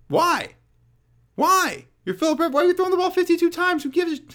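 high, exasperated disbelief; incredulous. Real, not acted. Ranting at coordinator's play calling.

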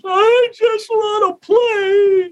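put-on high, drawn-out childish wail; bursting into tears. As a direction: cry it out like a sobbing little kid. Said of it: "I burst into tears".

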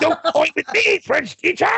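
high shouted rage; villain yelling at the French teacher, hammed-up; mock villain yell. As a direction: scream it at the teacher like a furious villain.

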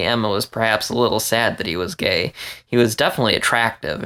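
plain reading voice

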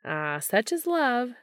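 slow, mock-dreamy sigh; musing. A narrator's reading. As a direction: muse like a hammy poet. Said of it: "Gabriel mused".

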